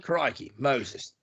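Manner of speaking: put-on softly tossed-off Aussie accent; terrible Australian accent. Host joking after his apology, bad Australian accent. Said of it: "my terrible Australian accent".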